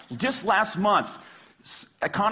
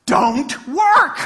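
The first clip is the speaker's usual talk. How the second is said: much louder and higher; emphatic shout; 11 semitones higher, 9 dB louder than usual. Real, not acted. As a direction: hit it hard, almost shouting. Climax of argument, shouted.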